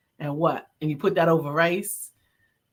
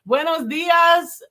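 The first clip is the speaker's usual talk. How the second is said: show host greeting; 8 semitones higher than usual. Acted.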